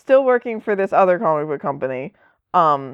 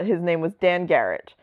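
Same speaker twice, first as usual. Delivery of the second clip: usual speaking voice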